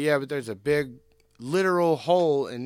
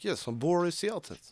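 put-on higher-pitched, Americanized Russian accent; terrible Boris Yeltsin impression; 5 semitones higher than usual. Co-host imitates the Russian leader for laughs, bad Russian accent. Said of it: "that was a terrible impression".

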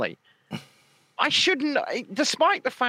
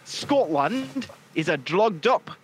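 slow, exaggerated Scottish accent; mock Scottish accent. Host teasing John with a lesson in his speech, mock Scottish accent. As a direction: spell it out slowly in a put-on Scottish accent. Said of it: "say words like John".

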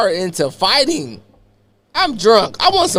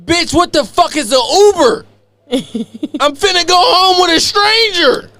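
Kenya's scolding; 12 semitones higher than usual. Retold or imitated. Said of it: "She say".

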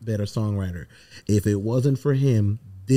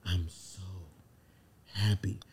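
a much softer, hushed repeat; 5 semitones lower, 13 dB quieter than usual. Retold or imitated. Answering his wife's question at the Grammys, hushed and softer. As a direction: hush it as if overcome, barely voiced. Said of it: "she was like, "What?".

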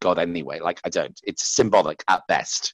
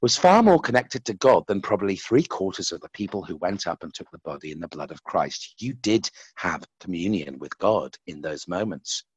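lightly voiced monk, quiet and tender; gentle wise monk; 4 dB quieter than usual. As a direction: soothe him with calm wisdom. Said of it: "And he's like".

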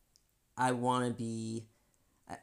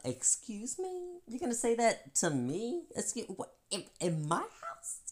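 indignant; 11 semitones higher than usual. Retold or imitated. Confronting a friend's slight, high and indignant. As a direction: act affronted in your own house. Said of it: "I was about to".

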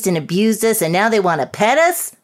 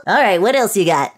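ordinary talk, nothing marked

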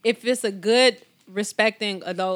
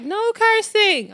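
high, slow mock kid voice; lightly voiced child saying no cursing; child scolding; 10 semitones higher, slower than usual. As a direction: imitate a little boy scolding his mom. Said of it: "he be like".

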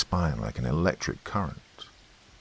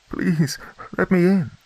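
high, slow desperate begging; pleading; 13 semitones higher than usual. A narrator's reading. Woman outside begging to be let in, desperate. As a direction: beg to be let in.

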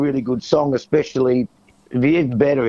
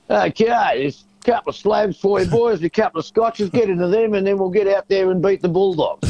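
a lightly voiced footy coach, high and bright; hammed-up footy coach pitched high; mock coach; 7 semitones higher than usual. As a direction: play the coach cheerily handing out slabs, pitched up. Imagining coach handing out beer.

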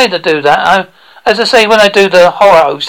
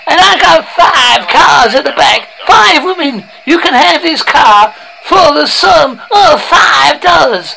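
shouting car salesman; 8 semitones higher, slower than usual. Acted. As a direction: holler a crazy sales pitch, stretching key words. Parody car salesman's shouted advert.

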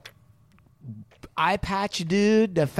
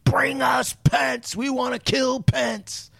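high, drawn-out strained chant; pinched mock scream, words stretched out; mock mob screaming; 8 semitones higher, slower than usual. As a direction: imitate a rioting crowd chanting, held back in volume. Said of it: "they were screaming".

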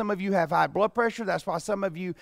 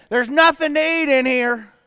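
put-on kid whining; 5 semitones higher, 6 dB louder than usual. Kid complaining at full fridge.

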